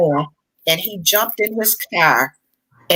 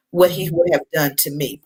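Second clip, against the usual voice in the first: ordinary delivery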